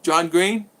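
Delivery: ordinary talk, nothing marked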